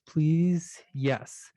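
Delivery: ordinary talk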